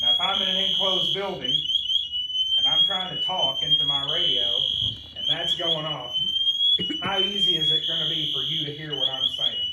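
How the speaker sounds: yelling over a blaring alarm; shouting over alarm. Shown as a demonstration. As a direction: shout above a loud beeping. Pass alarm blaring during speech.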